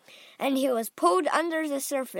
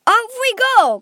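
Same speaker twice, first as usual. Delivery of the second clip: high, loud rallying call; brisk shout to get the group moving; crying out; 12 semitones higher, 13 dB louder than usual. A narrator's reading. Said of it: "cried Mister Wonka".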